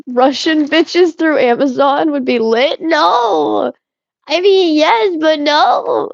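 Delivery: laughing, pitch jumping high, then a high no; flustered laughing. Real, not acted. Reacting to chat, laughing and flustered, pitched high. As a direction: caught off guard, giggling and protesting.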